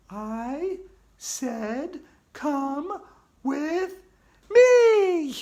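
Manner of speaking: rising to a very high, loud shriek; insist, building to a scream; building ghostly command. Acted.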